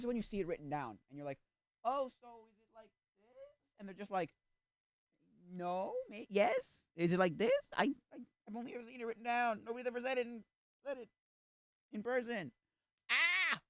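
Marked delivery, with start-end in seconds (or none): 1.8-3.5 acted awkward question. Acted awkward question about a name. 5.5-12.5 high-pitched, stammering mock panic; acted flustered excuse. 13.1-13.6 short, high, loud yell; mock panicked scream. Acted panic at awkward moment.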